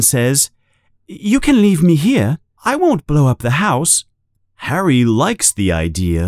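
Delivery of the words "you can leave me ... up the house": put-on higher, hopeful boy voice; light pleading kid; eager boy. A narrator's reading. Harry asking his aunt to stay home, eager.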